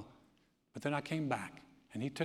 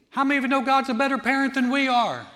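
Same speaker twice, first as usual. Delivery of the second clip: ordinary delivery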